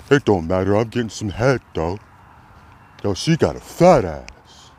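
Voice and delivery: put-on voice of a guy boasting about girls; mock street bragging. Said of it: "this is the talk".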